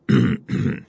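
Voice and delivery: low, puzzled cough; puzzled throat clearing. A narrator's reading. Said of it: "озадаченно произнёс он".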